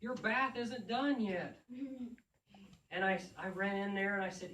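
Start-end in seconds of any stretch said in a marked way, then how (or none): 0.0-1.6 put-on high, slow, loud little boy; child scolding. Son Ethan chasing the wet cat, high slow child's call.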